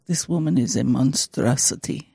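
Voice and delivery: low, soft mock Russian accent; Russian-sounding accent. Igor appalled on seeing her, in low Russian accent. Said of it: "The way you're talking in that voice".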